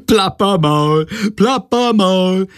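slow, high teasing chant; giggly taunt. A narrator's reading. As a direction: draw the word out high in mockery. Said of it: "hält sich den Bauch vor Lachen". (albern und gedehnt, hoch gespottet)

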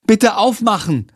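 put-on high-pitched child's command; bossy little brother. A narrator's reading. Tom demanding help with his lolly, bossy. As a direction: order it like a small boy. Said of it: "befiehlt sein kleiner Bruder".

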